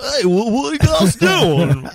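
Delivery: slow, high, hammed-up Southern drawl; bad Southern accent. Imitating Keanu's Devil's Advocate Southern accent, slow. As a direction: drag it out in a mock Southern accent. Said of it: "has a Southern accent".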